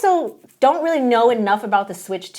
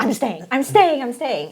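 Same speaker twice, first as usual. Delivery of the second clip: slow, higher repeated resolve; mildly excited, stretched-out repetition; retold excitement; 3 semitones higher, slower than usual. Employee excited by the secret project, retold resolve. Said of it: "I was so excited".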